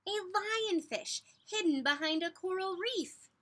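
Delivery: put-on spring the surprise on the children; dramatic reveal. A narrator's reading. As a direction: read it like a big reveal. Reveal in picture book.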